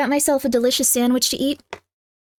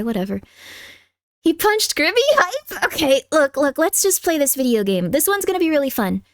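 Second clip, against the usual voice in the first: usual speaking voice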